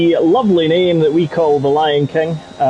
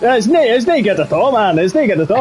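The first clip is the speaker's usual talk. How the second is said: a lightly put-on Scottish accent, quick; a touch of Aberdonian Scots, mocking; 5 semitones higher, faster than usual. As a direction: poke fun in a hammed Scots voice. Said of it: "You sound more Aberdonian".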